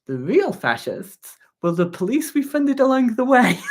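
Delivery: laughing punchline. Real, not acted. Streamer delivering the closing joke to viewers, laughing loudly. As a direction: crack up as you deliver the joke.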